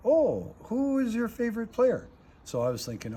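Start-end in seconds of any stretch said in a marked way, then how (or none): 0.0-2.1 high mock-eager interrogator voice, slow; imitating the interrogator, much higher and louder. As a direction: play the Russian officer, pitched up and slowed. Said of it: "he said".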